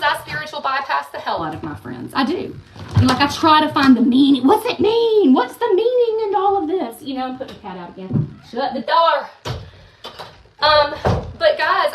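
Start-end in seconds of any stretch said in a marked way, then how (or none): 4.4-7.0 high, loud, urgent questions; mock frantic searching. Imitating her own fixer self, high and urgent. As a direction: spiral into searching for meaning.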